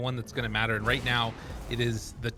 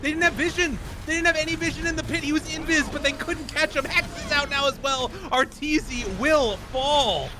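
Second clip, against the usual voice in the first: higher, louder hype commentary; excited play-by-play; 13 semitones higher, 5 dB louder than usual. Acted. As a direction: call the teamfight live, fast. Live fight play-by-play.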